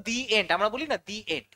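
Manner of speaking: "দি" pronounced dee, leading into "এন্ড"; "dee" before vowel. Shown as a demonstration. Teacher's example before a vowel sound, "দি" said as dee. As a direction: say "দি end" with an ee in the article. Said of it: "দি উচ্চারণ করব".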